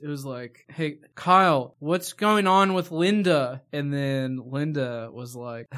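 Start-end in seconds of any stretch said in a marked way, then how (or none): 0.7-3.6 slow, louder acted line delivery; recreated movie line. Acting out the Kyle scene from the movie, slow and loud. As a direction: call out to Kyle, drawing the words out. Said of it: "Let me recreate the scene".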